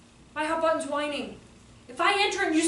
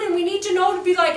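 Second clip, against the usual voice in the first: normal talking voice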